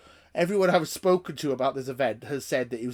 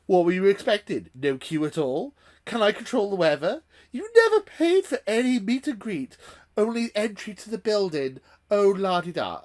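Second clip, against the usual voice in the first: higher, louder sarcastic sing-song; mock him as a smug, dismissive prat; snide mockery; 7 semitones higher, 5 dB louder than usual. Voicing the organiser's smug message, high sarcastic mockery.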